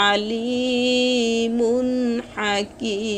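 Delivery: আলিমুন chanted very slowly, vowel before মিম held three alifs; long drawn vowel. Shown as a demonstration. Teacher repeating the example for students, slow chant. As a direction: stretch আলিমুন long, then a short হাকিম. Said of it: "তিন আলিফ টেনে পড়ে মিমের সঙ্গে যোগ".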